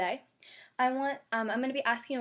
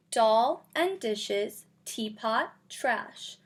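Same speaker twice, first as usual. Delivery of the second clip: lively, louder picture-book read-aloud; reading to children; 5 dB louder, slower than usual. Acted. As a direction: read it out for a class of little kids. Teacher reads picture book aloud.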